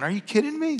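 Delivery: a light touch of a baffled God; God incredulous. Retold or imitated. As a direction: play God amused at the request. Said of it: "God's going".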